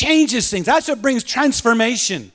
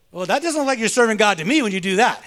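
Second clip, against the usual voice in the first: ordinary talk, nothing marked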